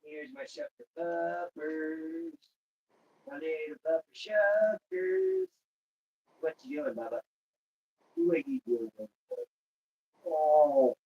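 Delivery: soft cooing pet voice. Real, not acted. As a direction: coo softly to a puppy. Cooing to his dog.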